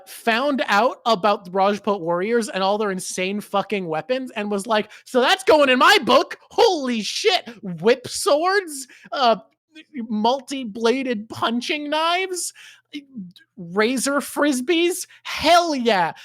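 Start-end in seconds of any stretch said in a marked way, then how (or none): 5.1-16.1 much higher, thrilled, slowing on the list; excited author. Retold or imitated. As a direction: gush over the weapons like a fan.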